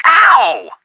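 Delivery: sudden high loud yelp of pain. Acted. Joe yelping after Frank hits him for the joke. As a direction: yelp as if just smacked.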